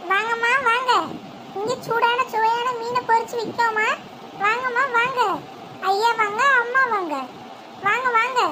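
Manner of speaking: calling customers, pitch up, singsong; singsong street hawker. Acted. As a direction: hawk fried fish to passersby. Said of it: "நீ நாலு பேரை கூவி கூப்பிடு".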